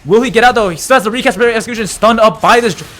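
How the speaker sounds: excited play-by-play. Real, not acted. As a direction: shout the play as it happens. Climactic kill, caster hype.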